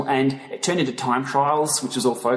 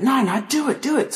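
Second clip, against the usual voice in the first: high, insistent retold encouragement; imitated urging; 9 semitones higher than usual. Friend pushes a reluctant vlogger to start, urging. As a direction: egg a friend on, pitched well up.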